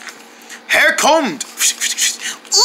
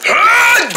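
startled yell; 14 semitones higher, 4 dB louder than usual. Acted. Sink squirting water.

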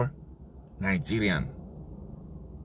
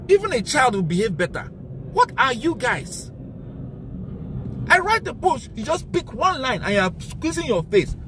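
angry; 11 semitones higher than usual. Real, not acted. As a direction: scold them in real anger, pitched up high. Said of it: "But I'm angry, very angry".

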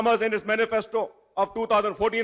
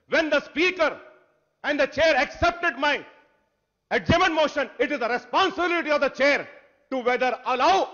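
higher, louder indignant demand; heated protest; 6 semitones higher, 4 dB louder than usual. Real, not acted. Opposition member angrily demanding answers from the Speaker. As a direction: hammer the chair with angry questions.

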